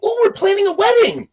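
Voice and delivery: very high, excited mother's voice; high-pitched happy mom voice; mom's high delight. Retold or imitated. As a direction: squeal it like a thrilled mom. Mom reacts to news.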